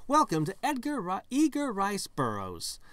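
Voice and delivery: high, loud welcome announcement; announcer sign-reading. Acted. Signpost welcoming the visitor, big announcer voice. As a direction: proclaim it like a park announcer.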